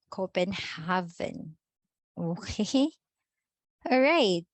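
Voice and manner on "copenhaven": slow Copenhagen as Copen-HAH-ven; V sounded out. Shown as a demonstration. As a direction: slowly sound a clear v in Copenhagen. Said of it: "we all know that V is V".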